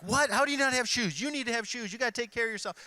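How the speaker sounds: high, hammed-up lecturing parent; mock baby boomer dad scolding his daughter; baby boomer mode. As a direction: overdo the worried parent, way up in pitch. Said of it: "baby boomer mode".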